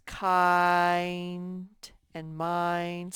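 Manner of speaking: "Kind and mind." drawn out very slowly, long I held in both; slow stretched demo. Shown as a demonstration. Teacher modelling the long I for young students, very slow. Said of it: "what spells the long vowel sound".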